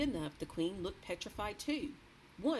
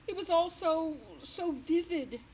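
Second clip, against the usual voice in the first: a touch of fright; 12 semitones higher, 7 dB louder than usual. A narrator's reading. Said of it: "upset recounting dream".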